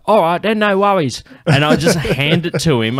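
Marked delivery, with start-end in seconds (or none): 0.1-1.2 loud, high-pitched mock Cockney; breezy Cockney imitation, pitched right up. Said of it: "He's just like".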